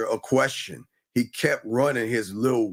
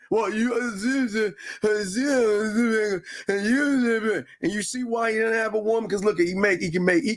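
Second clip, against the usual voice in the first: mock stammer; 8 semitones higher than usual. Mocking stammering caller.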